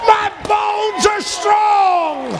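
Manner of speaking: high, very slow, drawn-out shout; slow triumphant shout; preacher's climactic shout. Acted. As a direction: stretch each word like a victory cry.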